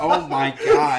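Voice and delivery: slow laughing gasp. Real, not acted. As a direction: laugh out the words. Reacting to joke.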